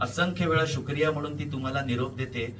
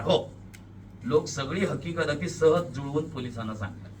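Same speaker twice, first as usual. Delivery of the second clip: plain reading voice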